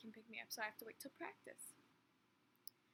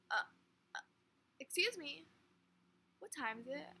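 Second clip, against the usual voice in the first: a louder, higher retold question, imitating the man; asking her out loud; 4 semitones higher, 6 dB louder than usual. Man turns to the girl and asks her the time out loud. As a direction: turn and ask her straight out, no longer muttering. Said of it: "looks me straight in the face and just goes".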